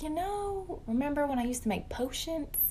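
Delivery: voce da bambina accennata, più acuta; lightly voiced little girl. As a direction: imita la figlia piccola che chiede con innocenza. Daughter voiced by mother, high.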